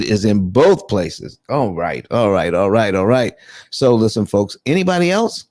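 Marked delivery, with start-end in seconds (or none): none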